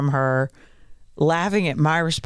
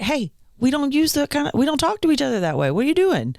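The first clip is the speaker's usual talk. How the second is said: higher, mock-stern scolding; a parent's firm correction; 10 semitones higher, faster than usual. Mom scolds daughter for cussing, mock-stern. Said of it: "I was just like".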